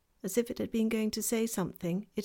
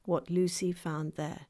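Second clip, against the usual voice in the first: ordinary delivery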